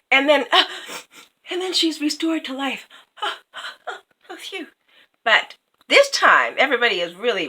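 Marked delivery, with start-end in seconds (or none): 3.2-4.0 sigh of relief. Retold or imitated. Relief after princess restored.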